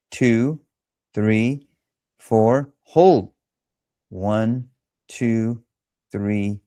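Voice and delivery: calm, unhurried breathing coach counting; guided breathing count. Acted. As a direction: count the breaths slowly and evenly. Leads box breathing count.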